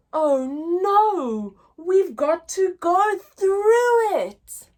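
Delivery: lively, sing-song picture-book reading; reading to children. Acted. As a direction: read it big for little listeners. Teacher reads the bear hunt story.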